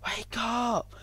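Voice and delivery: high-pitched cry to rouse someone; shouted wake-up call. A narrator's reading. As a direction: call out sharply high, without full volume. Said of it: "and shouted".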